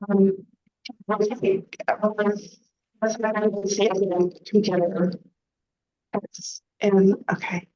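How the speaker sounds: muffled, underwater-sounding mic; like talking from underwater. Real, not acted. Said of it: "Still sounding underwater".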